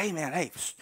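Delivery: low, hushed, furtive pitch; acted whisper. Moses secretly offers help to a Hebrew slave, whispering. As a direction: offer favours secretly, very softly. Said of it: "maybe he whispers to one".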